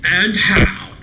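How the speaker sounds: excited exclamation. Real, not acted. Excited reaction.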